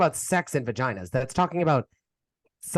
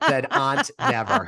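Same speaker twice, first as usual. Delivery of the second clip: sarcastic; 12 semitones higher, slower than usual. Acted. Sarcastic quip.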